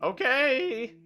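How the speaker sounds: high spirit voice; short high genie reply; elemental voice. Acted. Elemental answers the wish.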